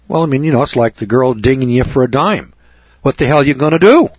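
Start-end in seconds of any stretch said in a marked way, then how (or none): none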